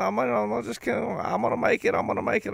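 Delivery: high, quick, anxious little voice squeaking he'll make it; nervous boss insisting he can make it; high-pitched mock panic trailing to a whisper; mock threatened boss. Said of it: "he feels a little threatened".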